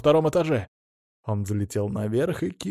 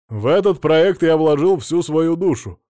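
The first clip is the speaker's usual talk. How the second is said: raised public-speech voice; slightly distorted TV voice; 4 semitones higher, 6 dB louder than usual. A narrator's reading. Businessman addresses viewers on regional TV, raised presenter voice. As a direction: project like a speaker in a newscast. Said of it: "его голос, слегка искажённый".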